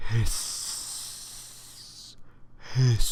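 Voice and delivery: long breathy snake hiss. Shown as a demonstration. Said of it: "Hissing right in your ears".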